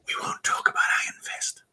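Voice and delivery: hushed unvoiced aside; whisper. Real, not acted. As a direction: whisper it like a secret. Aside about a disliked show.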